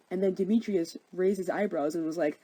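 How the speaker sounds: ordinary talk, nothing marked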